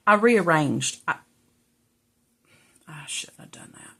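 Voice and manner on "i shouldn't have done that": whisper. Real, not acted. Unvoiced, regretful aside.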